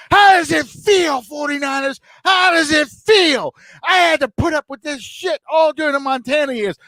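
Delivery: vengeful fan shouting in high voice; re-enacted screaming at TV. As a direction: holler it at the beaten team, stretching the words. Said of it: "I'm screaming at the T V".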